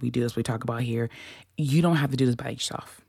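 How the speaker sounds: fast, tumbling delivery; words rushing out too quickly; rushed. Shown as a demonstration. Host reassuring listeners, rushed fast take. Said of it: "let me slow down".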